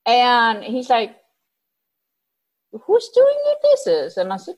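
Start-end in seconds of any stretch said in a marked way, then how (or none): 2.7-4.2 high, slow mock of the puzzled man; drawn-out baffled question, pitched high. Imitating the man asking about the dishes, high.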